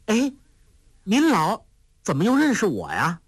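put-on a playful girl teasing her companion; lightly voiced sly young girl; lively young girl. A narrator's reading. Huang Rong voice again.

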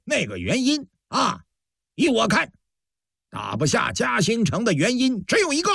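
high-pitched angry harangue; worked-up commander. A narrator's reading. Commander threatening his officers before battle, worked-up and angry. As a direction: berate the troops, voice pushed well up. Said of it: "程学启真是急眼了".

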